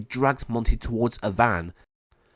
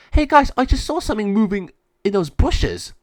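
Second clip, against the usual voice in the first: higher, louder alarmed whisper-call to friends; urgent tip-off, voice pitched up; excited warning; 11 semitones higher, 6 dB louder than usual. A narrator's reading. Reese warns friends of bushes, higher and louder.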